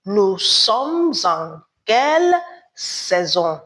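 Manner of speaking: "Nous sommes en quelle saison?" said slowly, each word spaced; slow it down so the class can repeat "Nous sommes en quelle saison?"; slowed teacher demo for repetition; slow model repeat. Shown as a demonstration. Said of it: "Répétez".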